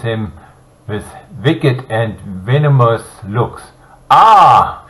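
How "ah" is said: loud, high mocking Ah; a loud taunting Ah; mocking cry. A narrator's reading. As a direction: jeer at the prince. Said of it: "she cried mockingly".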